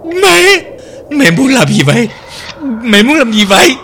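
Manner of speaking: high, bewildered begging; frightened pleading. Acted. Terrified daughter begging mother to explain why she is tied up. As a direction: plead with mother, not understanding.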